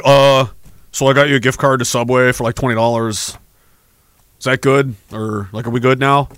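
low, quick, clueless guy voice offering a gift card; dim boyfriend. Retold or imitated. Clueless boyfriend apologizing to his angry girlfriend, mock sheepish low voice. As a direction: play the sheepish idiot boyfriend trying to smooth things over. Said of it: "comes over and he's like".

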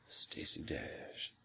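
slow, drawn-out whisper; low, breath-only murmur; hushed aside. Real, not acted. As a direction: whisper the name, lingering on it. Wistful aside naming an admired actress.

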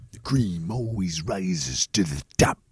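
a menacing catchphrase, dragged out low; wrestler catchphrase. Retold or imitated.

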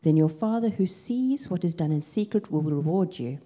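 ordinary talk

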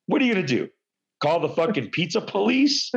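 ordinary talk, nothing marked

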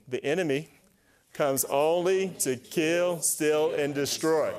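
slow congregational reading, only stretched; slow read-along. Shown as a demonstration. Congregation reading the verse along, slow and stressed. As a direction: read it slowly together with the church. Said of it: "You guys read it with me".

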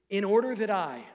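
"In order that I," with stress on "order", falling; leading the phrase. Shown as a demonstration. Pastor gives the congregation a phrase to repeat. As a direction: say "order" strongly so the room can echo it. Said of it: "say that after me".

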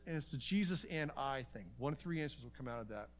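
ordinary talk

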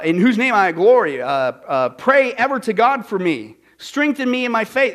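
loud, emphatic invocation; declaimed prayer reading. Acted. Preacher quoting prayer to a patron, loudly declaimed. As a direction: proclaim it as a grand plea.

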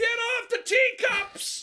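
slow, very high yell; shouting. Acted. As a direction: bellow it like a ride operator. Calling out as ride operator.

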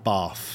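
bath as "bahf", long 'ah' and an f ending; long-vowelled "bahf"; southern long A. Shown as a demonstration. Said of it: "You know what I mean? Like".

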